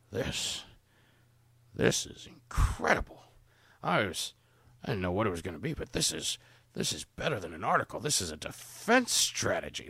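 put-on hoarse, half-whispered old drunk; breathy tipsy old man. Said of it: "he almost sounds like the drunk grandfather".

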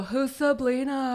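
slow sneering troll voice; drawn-out mocking troll. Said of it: "So the Sablina people".

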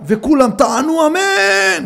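high, drawn-out call for amen; mock public announcement. Announcer embarrasses a man before all guests, mock proclaiming. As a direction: stretch the amen out across the hall.

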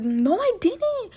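high, slow innocent denial; fake innocent. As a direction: play dumb, drawn out. Lying to mom.

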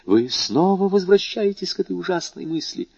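high-pitched appalled question; horrified bewilderment. A narrator's reading. Prosecutor to doctor, in horror and bewilderment. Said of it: "с ужасом и недоумением воскликнул".

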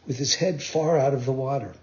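ordinary delivery, nothing marked

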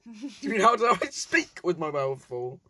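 ordinary talk

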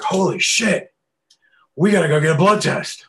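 loud, high-pitched panicked outburst; freaking out at full volume; losing it. Retold or imitated. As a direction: yell it in a retold panic. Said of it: "I lose my shit".

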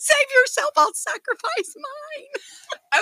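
high, slow, crying mock sacrifice; mock martyr. Sister mocking her noble drowning speech, high and teary. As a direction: overplay a tragic heroine. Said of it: "It was almost like".

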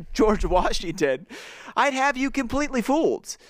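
high mock gentleman consoling the boys; mock-serious Chet. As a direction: deliver the verdict with hammed-up concern. Reading Chet's mock verdict.

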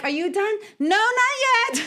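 put-on tiny kid. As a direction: answer like a gleeful toddler. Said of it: "And they're like".

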